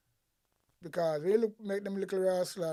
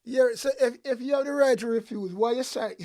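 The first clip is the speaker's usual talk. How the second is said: louder, higher, pressing the point hard; heated rant; 6 semitones higher, 8 dB louder than usual. Real, not acted. Commentator fires back at the minister, raised angry voice. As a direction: jab at the minister's logic, voice raised.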